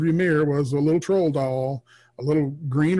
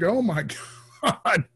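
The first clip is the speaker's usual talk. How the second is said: higher, stunned reaction with a laugh; laughing mock shock; retold astonishment; 6 semitones higher than usual. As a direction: imitate a friend's amazed disbelief.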